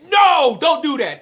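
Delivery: loud, high opening NO, easing after; retold yell. Mako telling Hana to stop singing, yelling. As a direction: yell the "no" in mock upset. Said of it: "she yells at Hana".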